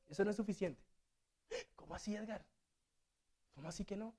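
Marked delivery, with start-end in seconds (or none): 1.8-4.1 soft, puzzled objection; a quiet congregant questioning the preacher; mock listener objecting. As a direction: play a doubting listener, quietly.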